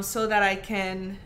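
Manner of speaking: ordinary delivery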